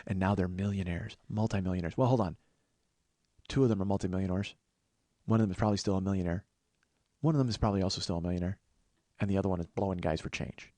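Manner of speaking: ordinary talk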